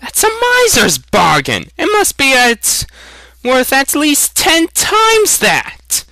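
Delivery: high, indignant youth, slowing on the last words; outraged young hunter protesting a lowball price. Acted. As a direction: push back hard, voice climbing. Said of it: "protested Aragorn".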